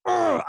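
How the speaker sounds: sharp high annoyed groan; frustrated groan. Retold or imitated. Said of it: "my trigger was a little bit quicker".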